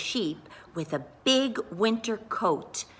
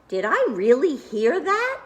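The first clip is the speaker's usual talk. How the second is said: surprised; 7 semitones higher, 4 dB louder than usual. A narrator's reading. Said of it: "he thought to himself".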